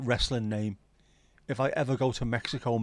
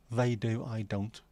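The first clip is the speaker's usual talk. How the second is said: normal talking voice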